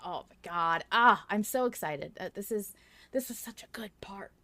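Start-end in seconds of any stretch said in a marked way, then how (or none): none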